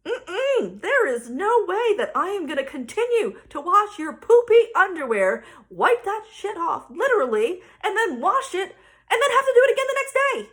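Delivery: loud, emphatic mom rant; fed-up refusal. Retold or imitated. Mom refusing to wash poopy underwear, loud and fed up. Mom's acted refusal to keep washing.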